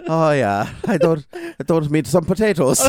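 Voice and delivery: high, laughing mock-Irish accent; Lena's Irish accent. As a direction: put on a hammed-up Irish lilt while giggling. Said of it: "make fun of, like, her not American accent".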